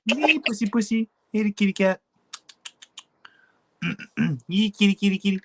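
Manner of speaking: very high coaxing pet voice. Real, not acted. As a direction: coo and call the kitty. Calling cat in baby talk.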